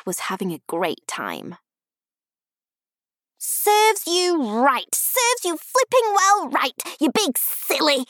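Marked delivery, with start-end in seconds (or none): 3.4-8.0 put-on high, slow, indignant little-girl shout; drawn-out, high-pitched telling-off; child shouting. A narrator's reading. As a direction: scold at full pitch like a cross child. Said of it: "She was shouting at Peter now".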